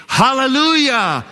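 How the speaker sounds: high ringing hallelujah shout; preacher shouting praise for the church to echo. Shown as a demonstration. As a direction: call it out to lead the crowd. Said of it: "say it".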